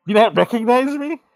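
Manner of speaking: high, loud disbelieving question; acted high-pitched indignant surprise; incredulous. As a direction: squawk it in disbelief. Masked Badass T is hurt, incredulous.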